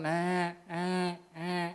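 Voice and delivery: short, repeated sheep bleats; tiruan embik domba. Retold or imitated. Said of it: "Apa mirip sih suaranya?". (menirukan suara domba mengembik)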